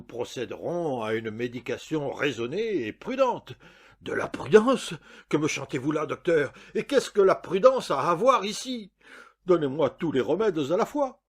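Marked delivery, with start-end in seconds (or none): 4.0-11.2 loud, raised-pitch indignation; indignant protest. A narrator's reading. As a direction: throw the doctor's word back at him, outraged. Patient angrily objects to caution.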